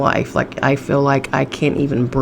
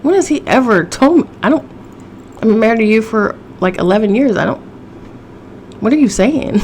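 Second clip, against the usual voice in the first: imitating the outraged wife, pitched up; indignant wife; 6 semitones higher than usual. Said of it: "And she said".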